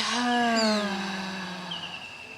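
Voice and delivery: audible sighing breath out; long exhale sigh. Shown as a demonstration. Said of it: "Exhaling with a sigh".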